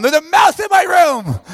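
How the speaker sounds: yelling for help. Retold or imitated. Speaker calling to Morgan and friends, panicked high yell. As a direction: call out in panic to the passing girls. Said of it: "start yelling at them".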